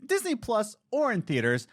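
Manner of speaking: high, bright segment announcement; show-host read. Acted. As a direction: pitch the segment like a radio host.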